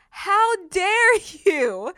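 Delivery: high, loud, drawn-out indignation; indignant retort. Retold or imitated. Believer confronting the accusing enemy, indignant. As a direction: stretch it out as a challenge. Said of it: "I'm like".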